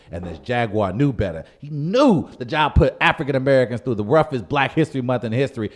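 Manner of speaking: usual speaking voice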